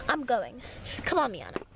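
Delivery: ordinary talk, nothing marked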